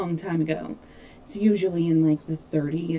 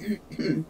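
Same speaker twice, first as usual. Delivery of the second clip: coughing up water; 2 semitones lower, 6 dB quieter than usual. Real, not acted. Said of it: "Water just went down the wrong pipe".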